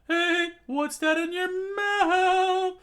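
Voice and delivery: loud, very high screech, last word drawn out; furious shouting. Acted. Cook accuses his captain of lying, furious shouting. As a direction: yell an accusation in piercing falsetto. Sanji catches Luffy with food.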